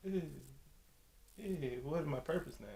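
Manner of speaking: soft, whimpered imitation of a breakdown; mock crying. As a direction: whimper it, half-hearted. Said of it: "has to break down crying".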